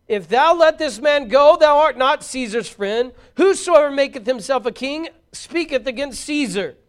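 loud, high, slow warning cry. A narrator's reading. The crowd crying out against releasing Jesus, loud. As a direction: shout the threat at Pilate.

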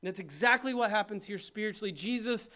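high, loud, fervent preacher build; full-force preaching. Acted. Pastor urging the congregation to be broken, shouting. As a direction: preach it out to the whole room at full volume.

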